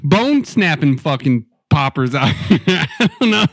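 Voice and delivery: ordinary talk, nothing marked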